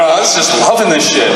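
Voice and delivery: ordinary talk, nothing marked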